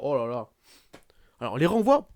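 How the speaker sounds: ordinary talk, nothing marked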